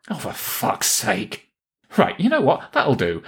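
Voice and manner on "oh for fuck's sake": slow, breathy muttered curse; exasperated groan. Real, not acted. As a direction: sigh the curse out, fed up. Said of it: "exasperated outburst".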